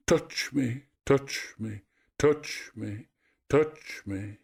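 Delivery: a touch of sobbing, dying away; mild sobbing echo. A narrator's reading. Echo's voice lurches among mountains, faintly sobbing. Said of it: "collapsed in sobs".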